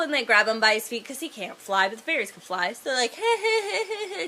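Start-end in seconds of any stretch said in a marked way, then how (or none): 3.2-4.3 high, mocking giggle; hammed-up singsong taunting laugh; teasing. Fairies dangle him by his feet, high teasing laughter. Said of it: "teasing him".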